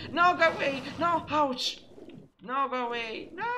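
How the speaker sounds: high, loud panicked protest; startled high yelps; alarmed cries. Real, not acted. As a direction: shoo off an attacker in a panic. Attacked by crocodiles in the game.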